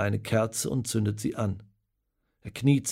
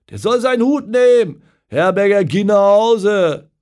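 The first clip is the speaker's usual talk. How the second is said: loud, high, jeering pub shouts; crowd outrage; 9 semitones higher, 10 dB louder than usual. Acted. Pub regulars shouting at Herberger during the match, loud jeering. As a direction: rail at the coach and players.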